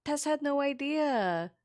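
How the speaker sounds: loud, high-pitched gushing wonder; delighted amazement. Acted. Tiefling rushing over to the wolf turned person, delighted amazement. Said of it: "gasps and smiles and runs over".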